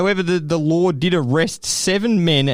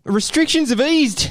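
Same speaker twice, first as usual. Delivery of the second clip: high-pitched mock English announcement; hammed-up English official; bad English accent; 12 semitones higher than usual. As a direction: launch grandly into a terrible English accent. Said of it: "That was the worst English accent ever".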